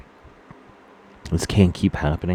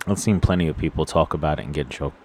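ordinary talk, nothing marked

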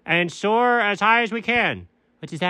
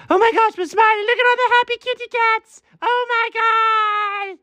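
excited squeal. Acted. Delighted by smiling reflections.

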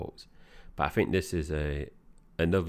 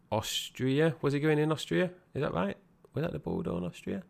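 rising higher and higher, suddenly unsure; sudden doubt; 10 semitones higher, faster than usual. Real, not acted. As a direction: catch yourself mid-sentence, questioning. Unsure of the location.

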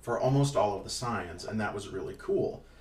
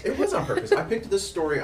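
mock insistence; 9 semitones higher, 6 dB louder than usual. Insisting playfully.

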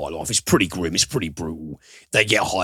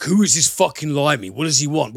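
mock-outraged jeering, pitched higher and louder; imitating angry coworkers, raised and indignant; hostile sneer; 8 semitones higher, 5 dB louder, faster than usual. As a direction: voice the resentful colleagues with a hammed-up sneer. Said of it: "who are like".

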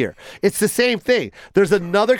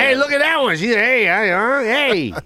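lightly hollered catcall; construction worker catcalling; 3 semitones higher than usual. Acted. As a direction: call out to a passerby like a street worker. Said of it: "you're just yelling at people".

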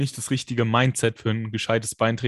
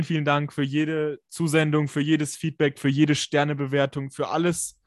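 ordinary delivery

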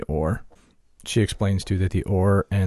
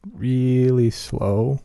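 slow; 4 semitones higher, much slower than usual. Pretend. Describing droid rolling slowly.

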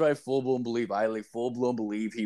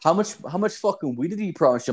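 higher and fast, pushy mock demand; imitating tough guy; 9 semitones higher, faster than usual. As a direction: rattle it off like a hustler pressing. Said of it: "they're just like".

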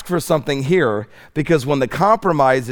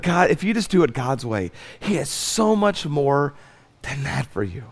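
tender appeal; 4 dB quieter than usual. Acted. Tender appeal after warning.

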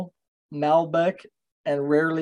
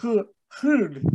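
imitating a French R on rude, higher; learner's try at a French-accented rude; attempted French accent; 5 semitones higher than usual. Shown as a demonstration. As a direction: push air through the throat for the R in rude. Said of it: "say it in a French accent".

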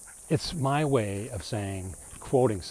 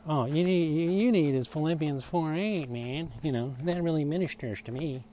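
mocking voice; 5 semitones higher than usual. Believer recommending a verse to a friend, mocking and higher. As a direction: parody an earnest verse-plucker. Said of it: "yes, I have my mocking voice on".

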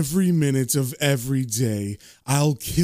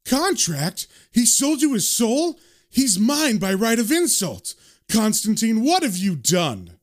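high, outraged devil's outburst; indignant yell, not at full volume; 6 semitones higher than usual. A narrator's reading. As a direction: cry it out in disbelief, pitch jumping up. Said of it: "and then he yells".

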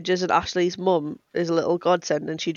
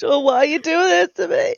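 high, wailing mock sob; mock cry aimed at the judgy cashier; fake crying; 10 semitones higher, 3 dB louder than usual. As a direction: pretend to break down in tears. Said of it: "Yeah, or just cry".

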